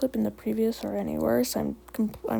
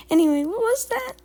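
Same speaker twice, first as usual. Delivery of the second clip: high, loud, curious kitty pet voice; awkward Skipper; 11 semitones higher, 6 dB louder than usual. A narrator's reading. Skipper questioning Leaf Paw, high-voiced and awkward.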